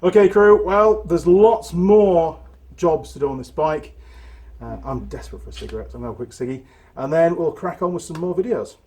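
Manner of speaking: loud, upbeat sign-off to viewers; YouTuber outro. Acted. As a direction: wrap it up for the channel.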